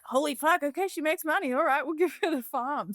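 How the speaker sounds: high, impressed and laughing; playing stunned bank staff; mock bank amazement. Bank staff seeing her income, high mock amazement. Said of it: "And then they were like".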